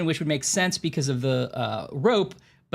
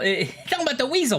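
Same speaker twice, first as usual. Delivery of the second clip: high, fast little weasel; 10 semitones higher, faster than usual. Retold or imitated. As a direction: squeak it out like a sly weasel.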